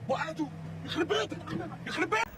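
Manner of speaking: very high, very soft squeak; tiny high strangled cry; squeaky whisper. Real, not acted. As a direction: gasp it out in shock. Dropped object, strained squeak.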